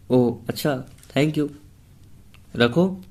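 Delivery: rising, curt angry sign-off. Acted. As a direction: snap sarcastically then hang up. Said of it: "मानवी गुस्से से बोली".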